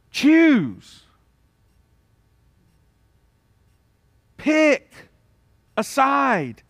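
high, drawn-out commands with long pauses; emphatic preaching. Real, not acted. Preacher demands a decision from the congregation, emphatic preaching. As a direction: press the congregation hard, one word at a time.

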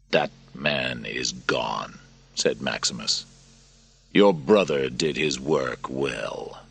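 low, very slow, heavy; bitter soldier. Acted. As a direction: close the door on the past. Narrator voices Maximus.

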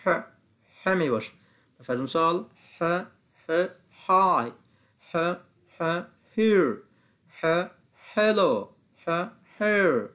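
short ه, then "های", "هیر", "هلو" drawn out; H sound drill. Shown as a demonstration. Teacher drilling the H sound, emphatic slow examples. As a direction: sound out ه, then model each H word slowly.

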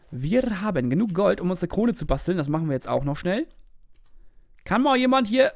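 ordinary talk

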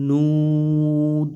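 নুদ খুব ধীরে, দাল সাকিন করে টেনে পড়া; নুদ শব্দের শেষে দাল থামিয়ে উচ্চারণ; তিলাওয়াতের উচ্চারণ. Shown as a demonstration. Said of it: "এরকম, হ্যাঁ?". (সাকিন দালে থামা উচ্চারণ)

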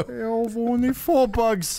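high, slow mock Cockney; deadpan a peasant in Cockney; hammed-up Cockney villager; Cockney accent. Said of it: "doing the Cockney accent".

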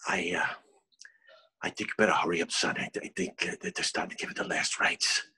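grave, near-whispered warning; hushed nun. Acted. Nun urging son to hurry to mother's last rites, hushed. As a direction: speak low and very softly, with hesitations.

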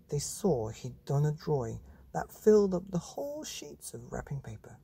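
plain reading voice

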